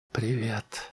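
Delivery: soft breathy hello from a dreaming woman; whispered. A narrator's reading. Sleeping wife whispers a sultry greeting to nobody. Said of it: "прошептала жена".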